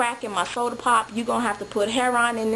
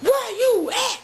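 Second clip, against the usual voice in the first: much higher, laughing mock rant; crazy boyfriend; 11 semitones higher than usual. Boyfriend on the pager demanding she come back, high mock rant. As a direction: imitate a frantic demanding man. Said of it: "it was crazy dude".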